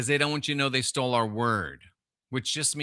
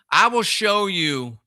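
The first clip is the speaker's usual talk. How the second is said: higher, louder and slow; solemn quote of Jesus; voicing Jesus; 6 semitones higher, 5 dB louder than usual. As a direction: proclaim it again like a preacher. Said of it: "he said".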